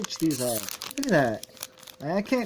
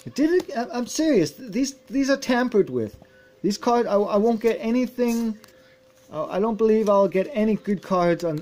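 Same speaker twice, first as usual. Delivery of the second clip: angry rant; 7 semitones higher, 3 dB louder than usual. Real, not acted. Angry rant over tampered packs.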